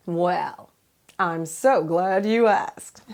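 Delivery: usual speaking voice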